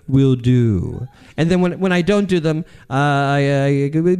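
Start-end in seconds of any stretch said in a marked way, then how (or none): 0.1-1.1 low put-on deadpan voice; empty promiser character. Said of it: "King of Empty Promises".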